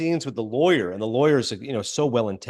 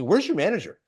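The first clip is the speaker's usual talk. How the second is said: higher-pitched indignant question; mock mad demand; 6 semitones higher than usual. Woman at the bank demanding the manager, mock mad. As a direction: lightly act out a demand. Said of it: "she gets mad".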